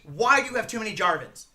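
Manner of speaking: normal talking voice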